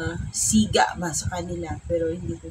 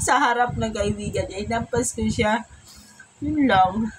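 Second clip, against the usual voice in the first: ordinary talk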